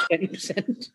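tickled, laughing delivery. Real, not acted. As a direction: giggle out the number. Laughing at the vodka punchline.

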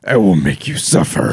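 very low menacing killer voice; Ghostface threat. Acted. Co-host joining in as the masked killer, Ghostface threat. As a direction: drop deep and threaten. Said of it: "The whole thing with his ghost face was that".

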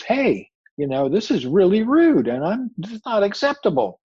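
high, loud, hammed-up protest; Brer Rabbit upset. Rabbit confronts a rude tar baby, high and upset. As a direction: act out mounting offense as a storybook rabbit. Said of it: "starts to get upset".

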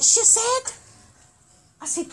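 ordinary talk, nothing marked

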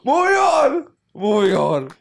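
high loud announcement; loud sing-song. Real, not acted. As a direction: call it out to move on. Loud high change of topic.

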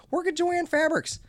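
high, loud sing-song; brightly sarcastic advice; mock cheerful. Real, not acted. Telling rude workers to be nice elsewhere, high mock cheer.

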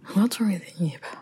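whispered aside; whispering. Real, not acted. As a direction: whisper the question to yourself. Aside while trying to remember.

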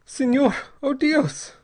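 high, loud, slow cry of despair; desperate, drawn-out high plea; girl's despairing cry. A narrator's reading. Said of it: "It was the girl's voice in despair".

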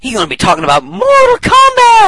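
high, loud announcer yell; hype shout. Acted. Hyping the next guest.

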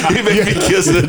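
high-pitched, laughing hard while talking. Real, not acted. Storyteller joking about mafia ring, laughing. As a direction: crack up while saying it. Cracking up at own joke.

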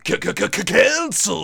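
loud radio DJ stutter yell; shock jock stutter. Acted. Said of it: "We need to get an audio where some guy's like".

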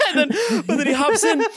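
high voice breaking into laughter; laughing while talking. Real, not acted. Storyteller cracking up at the friend's joke, laughing. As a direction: push the story on through real laughter.

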